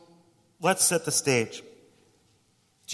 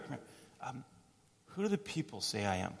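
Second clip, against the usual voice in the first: whispered, then much softer; hushed aside; 13 dB quieter than usual. Acted. Jesus leads disciples away from crowd, whispering and hushed.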